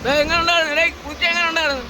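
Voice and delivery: high teasing call; excited. Real, not acted. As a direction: tease them with a bright high voice. Teasing friends after bath.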